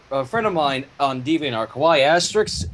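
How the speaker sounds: ordinary talk, nothing marked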